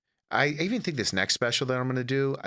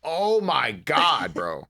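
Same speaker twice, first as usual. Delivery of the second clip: exasperated mom; 6 semitones higher, 4 dB louder, much slower than usual. Retold or imitated. Mom's exasperated reaction retold.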